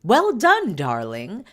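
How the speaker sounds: high, loud gushing well done darling; posh praise. Retold or imitated. Nanny praising Harry after his first hunt, gushing high and loud. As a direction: gush it out like an upper-class nanny. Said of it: "telling him".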